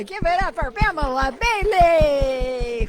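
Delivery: high, loud emcee shout; big high-pitched stage holler; rousing cheer. Real, not acted. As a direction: whip up the crowd's applause, drawing out the name. Rallying applause for the previous speaker.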